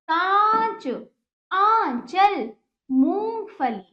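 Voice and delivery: long nasal vowels in कांच, आंचल, मूंगफली; emphatic, deliberate word-by-word teaching; pronunciation drill. Shown as a demonstration. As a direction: model each word separately, holding the nasal syllable. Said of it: "इस प्रकार इसका उच्चारण हुआ".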